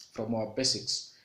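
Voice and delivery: ordinary talk, nothing marked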